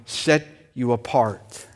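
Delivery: ordinary talk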